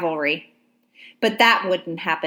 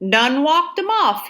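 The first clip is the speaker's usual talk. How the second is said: higher, drawled Georgia soldier voice; 7 semitones higher, 3 dB louder than usual. A narrator's reading. Soldier answers Ladysmith, Georgia drawl. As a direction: shrug it off with a twang. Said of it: "a Georgia accent".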